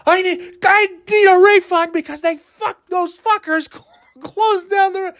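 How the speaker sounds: very high, strained mock rage at the booth; ranting demand. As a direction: demand the refund in a shrill huff. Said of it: "I'm like".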